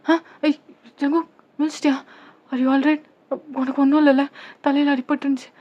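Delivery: high-pitched flurry of worried questions; acted relieved alarm; anxious concern. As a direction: check on him anxiously, voice lifted. Anxious check as he wakes.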